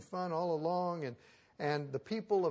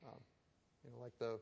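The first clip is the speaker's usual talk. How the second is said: normal talking voice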